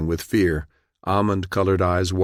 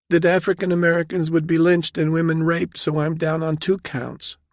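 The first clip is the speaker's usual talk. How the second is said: high, upset woman's voice; anxious junior officer, voice held high; frightened young captain; 11 semitones higher than usual. A narrator's reading. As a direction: explain the fear timidly.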